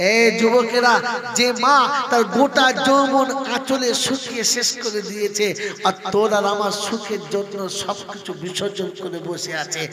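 soaring sermon wail; impassioned appeal to youth. Acted. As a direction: call out to the young men with full force. Preaching at full force.

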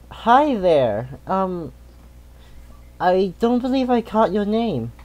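high, sweet introduction; character voice. Acted. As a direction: pitch up and charm him. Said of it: "roleplay with Kikoa's character".

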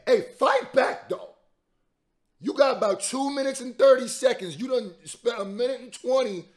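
loud, high hype shouting at the screen; hyped shouting. Real, not acted. Fan shouting encouragement at the rapper on screen, loud and high. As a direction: yell at him to fight back. Cheering on a rapper mid-battle.

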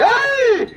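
high drawn-out "hey" cry; urging yell. Real, not acted. Commentator urging on racing bulls, high yell.

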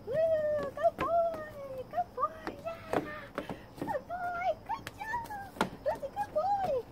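very high, squealing puppy praise; excited praise. Real, not acted. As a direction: gush over the dog as high as you can.

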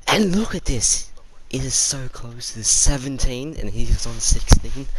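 high and loud, slow, thrilled by the close race; loud, excited play-by-play. Real, not acted. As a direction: call it out like a nail-biting finish. Close race, pointing out the score.

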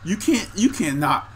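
normal talking voice

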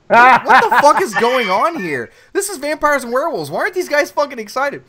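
loud, high retold disbelief; baffled outburst. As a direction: act out loud bewilderment at what you see.

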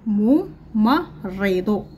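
الممرض stretched slowly syllable by syllable; الممرض drawn out slowly. Shown as a demonstration. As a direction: spell out الممرض slowly for the class. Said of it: "لدينا الكلمات نقرأها".

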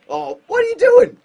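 very high, loud outraged cry; shouting at a clumsy player; exasperated shout. Real, not acted. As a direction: yell at the screen in disbelief. Yelling at own player's bad deke.

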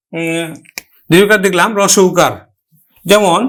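normal talking voice

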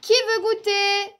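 high, loud sing-out, drawn out; calling out. A narrator's reading. Mother calling the cubs in for a snack, sing-out call. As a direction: call the children in from outside. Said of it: "Mais maman appelle".

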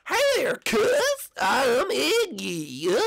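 stupid southern accent, very high and loud; Iggy. Shown as a demonstration. Iggy greets his cuz, stupid southern accent. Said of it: "Sorta like a stupid southern accent".

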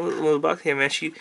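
ordinary talk

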